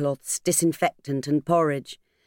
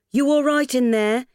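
raised voice, calling through the door; 8 semitones higher, 3 dB louder than usual. Acted. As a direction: call out to someone behind a door. Landlady calls through attic door.

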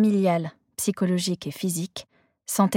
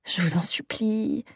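pleading under her breath; desperate whisper; 5 dB quieter than usual. Acted. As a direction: beg in a whisper. Silent prayer before reading.

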